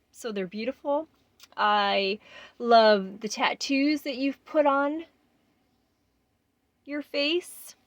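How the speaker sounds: getting choked up while talking to herself; emotional voice on the edge of tears; tearing up. Real, not acted. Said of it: "but I'm tearing up. I'm gonna cry".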